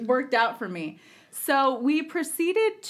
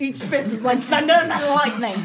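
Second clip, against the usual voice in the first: louder, higher New York boxer; mock New York accent; 4 semitones higher, 6 dB louder than usual. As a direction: put on a New York tough-guy accent. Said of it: "I might have added that accent".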